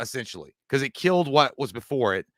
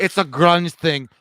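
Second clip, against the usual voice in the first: quoting Mark Dorman; 2 semitones higher, 8 dB louder, slower than usual. Retold or imitated. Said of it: "Mark Dorman says".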